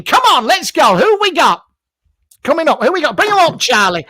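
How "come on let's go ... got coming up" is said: ordinary talk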